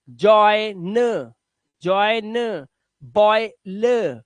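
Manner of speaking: जॉइन, बॉयल stretched, released on 'uh'; drawn-out 'oy' sound. Shown as a demonstration. Teacher sounding out examples for learners, drawn-out 'oy'. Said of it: "ओई की आवाज देनी है".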